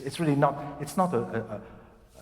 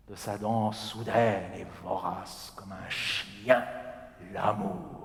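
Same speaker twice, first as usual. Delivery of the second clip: slow, lower, legato line reading; demo recitation; 5 semitones lower, 3 dB quieter than usual. Shown as a demonstration. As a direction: model the verse in one long breath. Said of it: "demonstrates the line".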